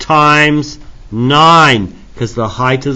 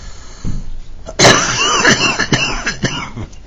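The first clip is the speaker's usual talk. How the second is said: chesty cough. Real, not acted. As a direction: cough it out hard and wet. Teacher coughs mid-lesson.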